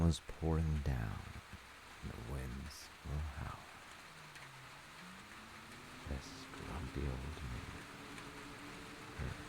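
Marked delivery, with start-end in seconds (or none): none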